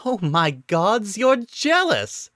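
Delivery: high, slow, gleeful you're jealous; teasing delighted gotcha; laughing accusation. A narrator's reading. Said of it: "she started laughing".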